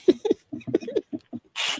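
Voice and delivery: bursting into real laughter; can't hold back the giggle; laughing. Real, not acted. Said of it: "I can't say this without laughing".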